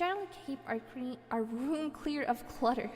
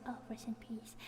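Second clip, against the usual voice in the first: soft reverent whisper; hushed whispered blessing; 9 dB quieter than usual. Real, not acted. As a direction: breathe it out like a little prayer.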